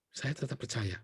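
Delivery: whispered-down affirmation; soft echo. Shown as a demonstration. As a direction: drop the voice to a quiet echo of the conviction. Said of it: "repeated conviction, hushed second take".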